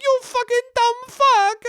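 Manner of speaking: high-pitched hammed-up Italian insult; exaggerated angry Italian voice; mock Italian scolding. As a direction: scold like an exasperated Italian caricature. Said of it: "And they're like".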